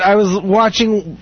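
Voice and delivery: ordinary talk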